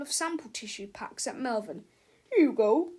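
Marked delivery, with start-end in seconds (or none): 2.3-2.9 salesman voice. Acted. Snoddy tossing tissues.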